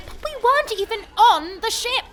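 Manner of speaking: high, loud protest of outrage; indignant outburst. Acted. Ruined shipowner protests the voided insurance indignantly. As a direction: protest the injustice.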